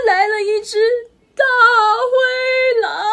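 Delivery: high, loud and slow dread; storyteller scaring children as the wolf arrives, high and slow; dramatic fright. A narrator's reading. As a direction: stretch out the big bad wolf.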